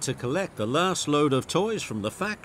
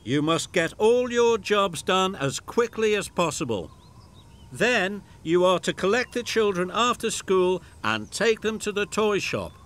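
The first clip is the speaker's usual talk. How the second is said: station master giving orders; 7 semitones higher than usual. A narrator's reading.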